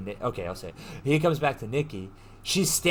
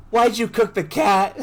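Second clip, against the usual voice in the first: high, loud incredulous demand; mock outraged yell; 8 semitones higher, 8 dB louder than usual. Boyfriend confronting her in the shower, mock outraged yell. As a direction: yell it in exasperated disbelief, half amused.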